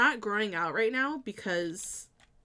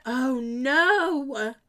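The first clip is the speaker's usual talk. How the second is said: alarmed; 4 semitones higher, 8 dB louder than usual. Real, not acted. Design goes wrong.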